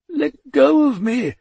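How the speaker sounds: wailing. A narrator's reading. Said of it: "Death wailed".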